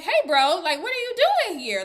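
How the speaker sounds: high, loud, quick imitation of delight; excited greeting. Diamond greeting his bro Andile, acted-out excitement. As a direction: burst out happily at seeing a bro. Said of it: "Like, he is excited".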